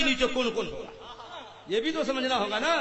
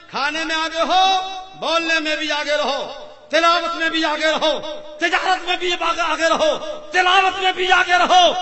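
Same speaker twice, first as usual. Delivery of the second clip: building exhortation; 7 semitones higher, 11 dB louder than usual. Real, not acted.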